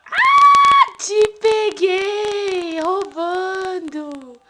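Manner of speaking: playful singsong. Real, not acted. Playful catch of baby.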